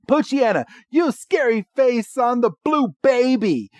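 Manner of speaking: high-pitched villain giving a mock command; Jesse. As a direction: order your monster into battle, hammed up. Voices Jesse ordering an attack.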